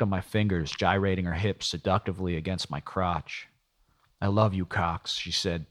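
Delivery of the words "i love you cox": ordinary delivery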